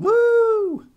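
loud high whoop; excited cheer. Real, not acted. Cheering as the actor appears, a loud whoop. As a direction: whoop like a fan at a concert.

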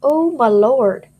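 normal talking voice

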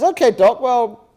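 hammed-up hopeful patient; eager patient. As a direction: bounce in, keen for the good news. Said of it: "And the guy says".

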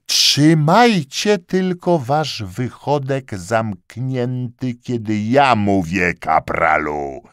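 high, loud, slow yelling; furious yell. A narrator's reading. Lieutenant silencing the corporal with a slow furious yell. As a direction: shout him down, slowly.

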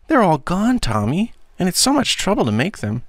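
high-pitched auntie voice; protesting aunt. Acted. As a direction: object gently, pitched well up. Narrator voices aunt protesting to boy.

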